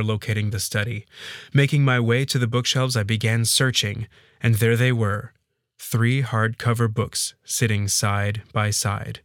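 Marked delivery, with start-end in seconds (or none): none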